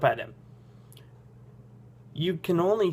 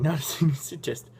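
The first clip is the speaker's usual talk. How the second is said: ordinary delivery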